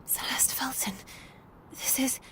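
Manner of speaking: soft, breathless whisper of a name; stunned whisper. Acted. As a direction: barely voice the discovery. Shocked recognition in a photo.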